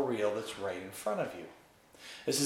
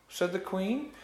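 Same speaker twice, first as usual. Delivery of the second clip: ordinary delivery, nothing marked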